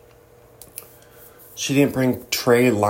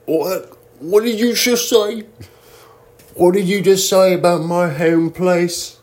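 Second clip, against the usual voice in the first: mock offended Brit; 7 semitones higher than usual. A fake Brit bristles at a question about the UK, in a mock British accent. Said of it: "They'd be like".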